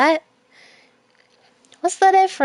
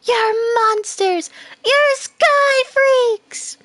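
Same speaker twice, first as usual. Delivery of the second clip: put-on high, slow, swooning girl bee; 13 semitones higher, 4 dB louder, much slower than usual. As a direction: gush over the jocks, very high. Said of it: "I have to sound like a girl bee".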